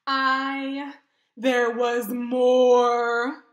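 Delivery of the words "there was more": slow, loud, drawn-out tease; big storyteller pause and push; dramatic reveal. Acted. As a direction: stretch it out to build suspense. Gerald builds suspense dramatically.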